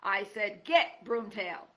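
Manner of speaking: loud, slow final order; shooing the fox away; cry out. Acted. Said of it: "Poppy heard Aerith cry".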